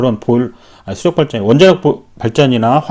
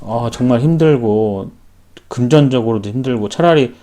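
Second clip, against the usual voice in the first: ordinary delivery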